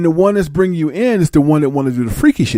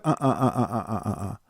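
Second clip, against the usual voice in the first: soft, breathless stammering uhs; shocked stammer; 5 semitones lower, 11 dB quieter, faster than usual. Retold or imitated. Newcomer stunned by the request, soft stammering.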